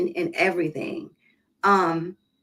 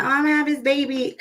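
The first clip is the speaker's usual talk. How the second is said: mock cute girl; 6 semitones higher, faster than usual. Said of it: "trying to be cute".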